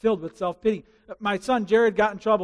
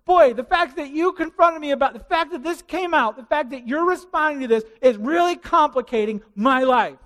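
higher, louder put-upon complaint; self-pitying grown-up; 7 semitones higher, 7 dB louder than usual. Retold or imitated. Grown-up confronted about sin, loud self-pitying complaint. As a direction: moan like everything is unfair to you. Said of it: "this is how grown-ups react".

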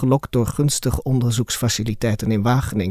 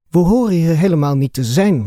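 louder grumbling complaint, higher; 5 semitones higher, 5 dB louder than usual. A narrator's reading. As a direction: grumble it out in protest. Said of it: "mopperde Octavia nu". (mopperend en hoger)

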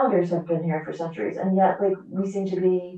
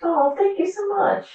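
thanks in a much higher voice; delighted thanks; 12 semitones higher than usual. Real, not acted. Guest thanking the host for praise, delighted and high. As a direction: lift way up, touched and delighted.

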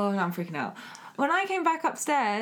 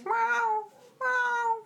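high squeaky meow; cat meow; 14 semitones higher than usual. Retold or imitated. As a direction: imitate a cat's meow. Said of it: "And he goes".

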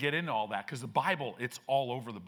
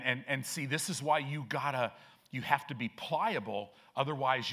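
usual speaking voice

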